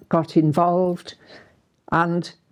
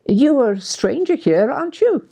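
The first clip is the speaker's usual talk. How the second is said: put-on Scottish accent; 7 semitones higher than usual. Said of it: "Lovely Scottish accent".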